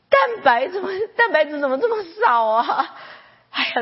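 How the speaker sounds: high, pained exclamations over the blood test; dismayed lament. Retold or imitated. Pastor reliving reading mother's blood report, high and dismayed. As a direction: wail in alarm at bad news. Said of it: "聚会前我还很难过".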